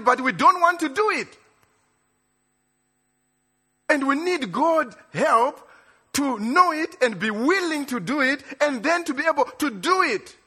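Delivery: preacher carried away, almost shouting; excited preaching. Real, not acted. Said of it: "Sorry, I'm getting excited. I'm not shouting at you".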